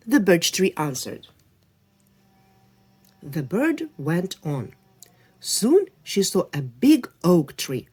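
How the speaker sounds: clear, lively storybook reading; story narration. A narrator's reading. Reading a folktale to children, lively storybook voice. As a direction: read the folktale to little listeners.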